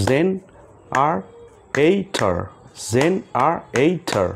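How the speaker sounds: জেনারেটর very slow in four beats, je-na-rei-tor; slow clapped syllables. Shown as a demonstration. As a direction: clap four times, slowly: জেনারেটর. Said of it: "আমরা তালির মাধ্যমে সেই ওয়ার্ডগুলোকে শিখব".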